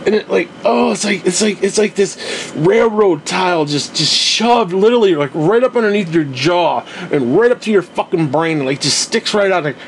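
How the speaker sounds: ordinary talk, nothing marked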